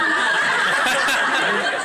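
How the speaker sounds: loud airy burst of laughter; breathy laugh. Real, not acted. Groom laughing at his own joke to the DIY ladies. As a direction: break up laughing.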